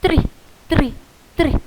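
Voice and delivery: tri tri tri tapped out in short beats; counting syllables. Shown as a demonstration. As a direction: count tri on each beat. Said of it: "é uma sílaba".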